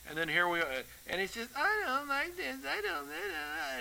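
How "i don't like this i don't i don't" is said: put-on high, soft whiny child voice; mock childish whine; kid whining. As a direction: imitate a whining kid, pitched way up. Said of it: "fingernails against the chalkboard whining".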